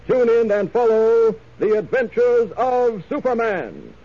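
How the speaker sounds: announcer. Acted. Announcer promo.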